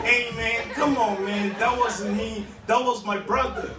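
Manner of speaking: put-on protesting in an American accent; put-on American voice, pleading innocence; wheedling American-accented denial. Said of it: "speaking in an American accent".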